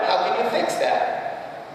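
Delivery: usual speaking voice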